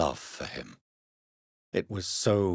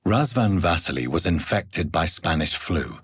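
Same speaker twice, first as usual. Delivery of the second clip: ordinary talk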